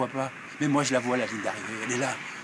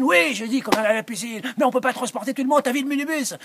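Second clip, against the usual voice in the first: exasperated; 8 semitones higher, 5 dB louder, faster than usual. Acted. Gérard arguing back, exasperated.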